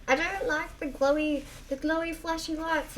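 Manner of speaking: put-on high whiny child voice; little girl voice. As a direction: whine it like a small kid. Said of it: "she's like".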